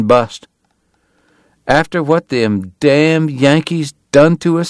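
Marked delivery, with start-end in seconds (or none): 1.7-4.7 higher, slow drawled grumble; aggrieved local, drawn out and pitched up; Southern bystander. A narrator's reading. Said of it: "someone remarked".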